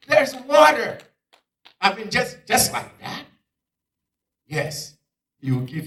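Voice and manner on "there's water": high, loud cry of discovery; thrilled, disbelieving exclamation; amazed. Acted. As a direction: shout it out in astonishment. Acting amazement at water.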